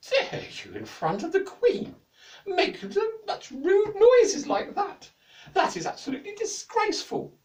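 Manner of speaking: very high, loud shriek of outrage; queen berating the jester, furious. A narrator's reading. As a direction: scold him, slow and shrieking.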